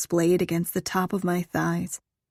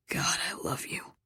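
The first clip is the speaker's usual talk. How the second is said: very soft, breathy declaration; whispered love; 10 dB quieter than usual. A narrator's reading. Lover overcome by her answer, whispering softly.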